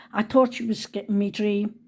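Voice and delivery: normal talking voice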